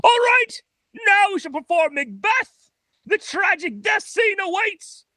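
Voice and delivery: high, grand theatrical declaiming; hammy Shakespearean actor. Retold or imitated. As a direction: ham it up like a stage actor. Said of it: "Christopher the actor walks out and he's like".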